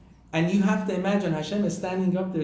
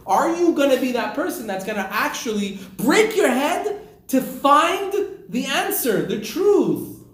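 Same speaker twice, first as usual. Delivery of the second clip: slow, louder and higher, preaching hard; fervent; 7 semitones higher, 6 dB louder, slower than usual. Acted. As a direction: drive each word home with passion. Rabbi's fervent lesson to student.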